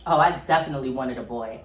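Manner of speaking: normal talking voice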